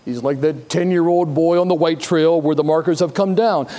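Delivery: ordinary talk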